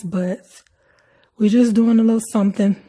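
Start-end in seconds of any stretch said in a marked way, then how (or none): 1.4-2.8 louder. Real, not acted. Defensive aside to viewers.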